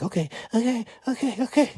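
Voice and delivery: very high, frightened okays; panicked okays. Retold or imitated. Woman frantically agreeing to find the money, very high panic. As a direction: beg in a panic to be spared.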